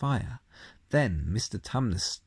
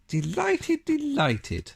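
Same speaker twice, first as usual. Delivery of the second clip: flustered faun; 3 dB louder, slower than usual. A narrator's reading. Faun covers slip.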